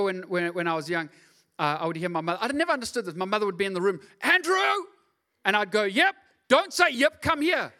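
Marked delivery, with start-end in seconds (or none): 4.2-4.9 high, loud call of a mother across the house; mother's loud summoning call. Retold or imitated. Mother calling him from another room. 5.8-7.7 high, loud, slow mock mum telling him off; loud, slow, mock scolding mother.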